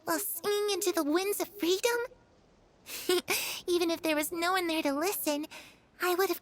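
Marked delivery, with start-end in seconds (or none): 2.9-3.6 a brief breathy laugh to herself; embarrassed little laugh at her own dream; short laugh. Acted. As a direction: laugh off the daydream.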